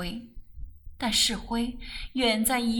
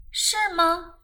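high, louder surprised question; astonished; 8 semitones higher, 5 dB louder than usual. A narrator's reading. Rose hears of the proposal, astonished. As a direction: pitch it right up in amazement. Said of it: "他诧异地问道".

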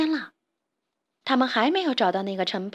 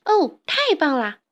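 higher, louder chirpy joy; delighted bird; 5 semitones higher, 5 dB louder than usual. Acted. Little bird thrilled to join Ferdinand, delighted. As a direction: burst with happiness. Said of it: "小鸟高兴极了".

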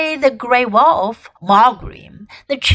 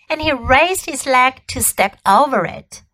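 ordinary delivery, nothing marked

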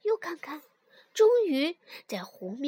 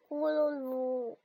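gurgling; slower than usual. Acted. Sinking gurgle.

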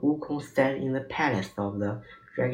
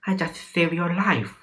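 higher, mildly indignant protest; angry cry; 6 semitones higher than usual. A narrator's reading. Wukong to the monk who scolded him, angry. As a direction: protest with a touch of anger. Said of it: "Wukong was angry".

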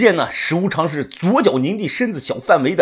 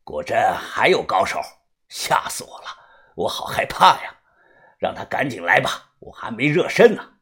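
low, soft mock fear; nonchalant; 9 semitones lower, 5 dB quieter than usual. A narrator's reading. Master scoffs at coming challenger, nonchalant low voice. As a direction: feign fright, utterly unconcerned. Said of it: "满不在乎地说".

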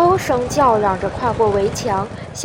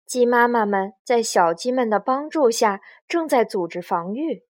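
ordinary delivery, nothing marked

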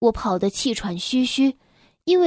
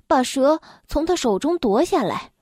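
ordinary delivery

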